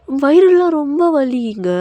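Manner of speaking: high, drawn-out wheedling whine; milk the aching stomach, slowly; whining wife. Acted. Wife coaxing husband for chocolate, whiny and pleading.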